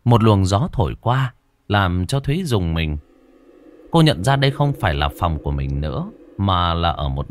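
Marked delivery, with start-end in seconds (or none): none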